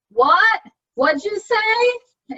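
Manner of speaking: loud, high, drawn-out "What?"; spouse who can't hear. Retold or imitated. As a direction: call out like a spouse who missed it. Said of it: "without my spouse saying".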